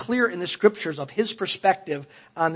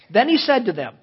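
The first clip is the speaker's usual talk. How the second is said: usual speaking voice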